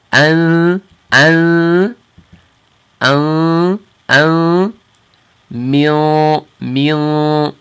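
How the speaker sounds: nasal "an" then "min" moving back in the mouth; ikhfa ladder. Shown as a demonstration. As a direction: step the nasal backward, front to back. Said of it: "So you hear the difference".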